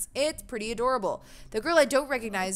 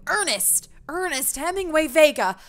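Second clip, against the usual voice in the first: scolding father; 8 semitones higher, 7 dB louder than usual. Acted. Father scolds son caught smoking.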